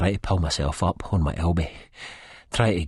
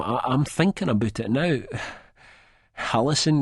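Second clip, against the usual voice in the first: plain reading voice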